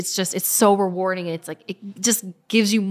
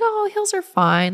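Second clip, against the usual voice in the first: airy, much higher, slow dismissal; breezy; 14 semitones higher, slower than usual. Retold or imitated. Runner shrugging off the hilly course, breezy and high. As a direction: brush off the hills lightly.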